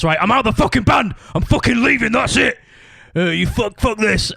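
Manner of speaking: high, strained mock yell; angry quitting outburst. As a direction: imitate a bandmate storming out. Bandmate quitting in anger, acted out.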